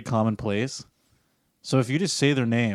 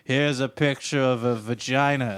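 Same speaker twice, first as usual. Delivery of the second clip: slow mock-Australian drunk buddy; hammed-up Australian drawl, imitating a friend; thick Australian accent; slower than usual. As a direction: do a bad, dragged-out Australian accent. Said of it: "he comes up with this thick Australian accent".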